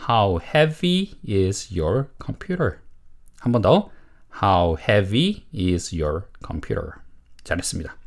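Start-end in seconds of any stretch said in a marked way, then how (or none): 0.1-2.8 slow, spaced-out model sentence; model reading. Shown as a demonstration. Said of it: "두 번 읽어볼게요". 4.4-6.9 slow, spaced-out model sentence; model reading. Shown as a demonstration. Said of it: "두 번 읽어볼게요".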